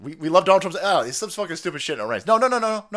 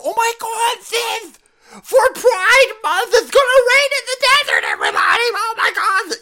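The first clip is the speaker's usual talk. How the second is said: very high shrieking mock voice; mocking shrieker; 8 dB louder than usual. Mocking media gushing about rain in desert, shrieking high. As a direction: scream it like a hysterical caricature. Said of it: "they go".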